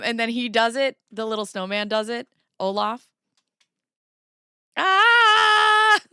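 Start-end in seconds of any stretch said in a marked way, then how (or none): 4.8-6.0 loud high yelp; high shriek. Acted. Trying a sound, loud high scream. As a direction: let out a shrill shriek. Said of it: "I sound like".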